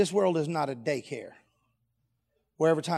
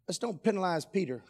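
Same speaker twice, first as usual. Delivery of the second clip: ordinary talk, nothing marked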